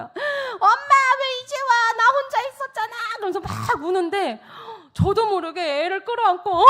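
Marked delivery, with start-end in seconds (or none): 0.0-2.9 high, loud mock wail of a little boy; crying child. Son to late mom at daycare, crying wail. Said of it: "울면서 나오는 거예요". 6.5-6.6 loud, high imitated wail; mock bawling. Mother hugging her child, imitated loud bawling. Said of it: "막 나도 울었어요".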